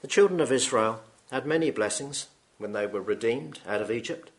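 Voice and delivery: ordinary delivery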